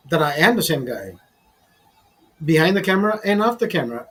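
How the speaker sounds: ordinary talk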